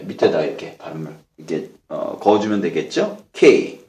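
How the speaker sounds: normal talking voice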